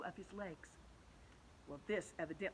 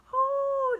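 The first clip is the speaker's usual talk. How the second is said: high, loud squeal of fright; frightened ladybug; 15 dB louder than usual. Acted. Ladybug startled by the cloud men, high frightened squeal. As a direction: yelp it out in alarm. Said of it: "the ladybug said".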